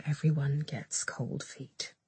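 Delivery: slow, near-whispered self-reassurance; very soft, breath-only inner voice; telling oneself. A narrator's reading. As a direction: murmur it privately to yourself, drawn out. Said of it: "she told herself".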